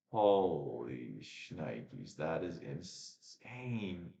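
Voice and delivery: very slow, drawn-out amazement; stunned disbelief. Real, not acted. Streamer seeing a forty-three dollar DoorDash bill, stunned disbelief. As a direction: stretch every word in disbelief.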